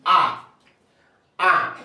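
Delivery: emphatic, isolated nasal "A"; nasal vowel demo. Shown as a demonstration. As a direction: say "A" through mouth and nose, firmly. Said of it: "Can you pronounce this?".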